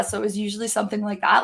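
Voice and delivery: ordinary talk, nothing marked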